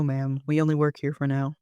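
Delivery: usual speaking voice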